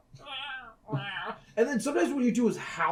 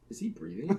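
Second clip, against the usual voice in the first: lower, softer worried question; worried hush; 5 semitones lower, 7 dB quieter than usual. Retold or imitated. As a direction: ask it quietly, afraid. Mom worried cat is dead.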